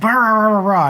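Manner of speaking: imitating an angry customer ranting nonsense; mock angry ranting. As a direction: parody his blustering. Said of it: "he got even angrier".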